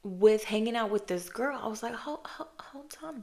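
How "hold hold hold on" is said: high, hushed whisper, almost soundless; whispered hold on. Retold or imitated. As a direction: stop yourself in a breathless whisper. Stopping herself, whispered quote.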